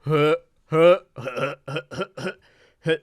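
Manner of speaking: breathy cartoon snicker in a put-on voice; Butthead laugh. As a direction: snicker like a dim teen cartoon character. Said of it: "Butthead would clown".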